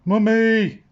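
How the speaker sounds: very high, loud cry for mummy; hammed-up wail of fright; frightened cry. A narrator's reading. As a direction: shriek it like a scared little pirate. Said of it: "cried Grumpy Greg".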